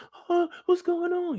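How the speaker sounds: put-on startled girl asking what's happening; scared high Ellie.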